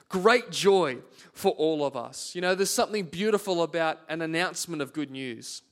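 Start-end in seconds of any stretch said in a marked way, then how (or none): none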